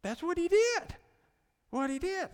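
high, loud preacher's outcry; emphatic exclamation. Real, not acted. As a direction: exclaim it, voice leaping up. Preacher exclaims over man's behaviour.

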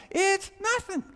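very high, loud cry of exasperation; exasperated outburst. Acted. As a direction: throw it out at the top of the voice. Climax of acted exasperation at self.

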